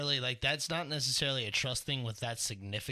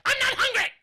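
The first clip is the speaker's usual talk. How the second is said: very high, squeaky mock girl voice; whiny girl imitation; 9 dB louder than usual. Uncle mocking how nieces refuse food, very high squeaky voice. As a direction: squeak it up like a sulky little girl. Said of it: "And the girls are like".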